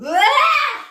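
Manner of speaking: very loud, high yell; powerful releasing cry. Shown as a demonstration. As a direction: scream out all the anger. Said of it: "bien fort, comme moi".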